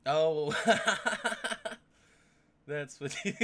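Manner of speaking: loud high laughter; realizing what Sark meant, laughing. Real, not acted. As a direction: burst into laughing.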